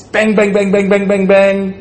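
rapid high-pitched "bang" on one note; imitated gunfire. Husband shooting rockets at his wife in an argument, imitated gunfire. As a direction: fire off the bangs like a machine gun. Acts out gunfire in marital war.

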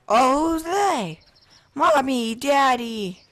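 high, loud mock child's call. Player as little kid calls to mommy and daddy, hollering. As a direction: holler playfully for your parents. Playing child calling to parents.

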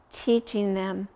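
ordinary delivery

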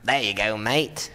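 put-on low, gruff old man voice. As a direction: grunt it like an old codger. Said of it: "a bit gruffer".

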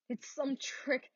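hushed. Acted. Shaken wife murmuring her suspicion, slow and hushed. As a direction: murmur it slowly to herself. Whispered doubt.

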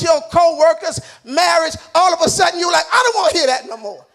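loud, emphatic pulpit delivery; full-force preaching. Real, not acted. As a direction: drive it home at full preaching volume.

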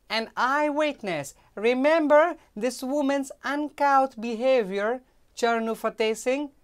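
high, loud pleading maid; insistent maid. Acted. Maid pressing Charnu to be her eyewitness, loud and high. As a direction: press the boy to remember.

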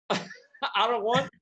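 drawn out, high, through laughter; slow, laughing whine of protest. Retold or imitated. Laughing retelling own protest.

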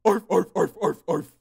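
loud barking arf in a cartoon dog voice; Goosebumps dog voice. Shown as a demonstration. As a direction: bark it out like a TV monster dog. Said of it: "Goosebumps dog voice".

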